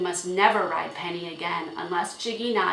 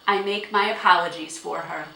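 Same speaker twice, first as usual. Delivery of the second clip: firm apology; 2 semitones higher, 8 dB louder than usual. A narrator's reading. Father firmly placates angry owner.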